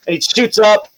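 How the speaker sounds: usual speaking voice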